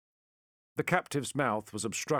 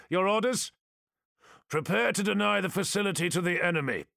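brisk military voice; 9 semitones higher, 4 dB louder, faster than usual. Acted. Military exchange of orders.

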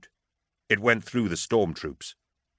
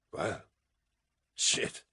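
drowning, bubbling voice, very soft; death rattle; 8 dB quieter than usual. A narrator's reading. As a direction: gurgle it through blood. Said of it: "his voice drowning and bubbling".

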